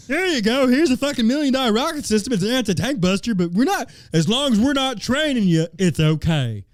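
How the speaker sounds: high, loud sarcastic offer; mocking handover. Host acting out arming Ukrainians without training, high mocking voice. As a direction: play it absurdly high and mock-generous. Mock handing over of weapons.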